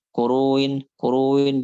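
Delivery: KURUIN run together, "u" gliding into "in"; softened hamzah. Shown as a demonstration. Teacher warns against tashil, softened hamzah in KURUIN. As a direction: slur "Kuruin" with no catch before "in".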